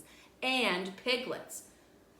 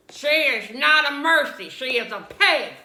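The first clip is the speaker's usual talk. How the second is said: loud scolding objection; indignant; 6 semitones higher, 18 dB louder than usual. A narrator's reading. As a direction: snap back, loud and annoyed. Eugenia objects to the name.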